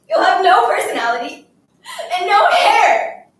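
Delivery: loud, overwrought tirade; tearful scolding. Acted. Girl haranguing her silent classmate while crying, tearful scolding. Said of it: "I couldn't stop crying and talking at the same time".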